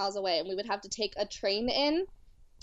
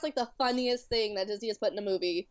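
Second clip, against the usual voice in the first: ordinary delivery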